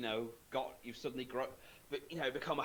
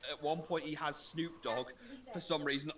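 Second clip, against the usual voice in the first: normal talking voice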